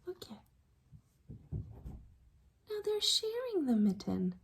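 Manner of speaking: gasped hushed whisper. Real, not acted. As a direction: pull them in with a whisper. Whispered aside to children.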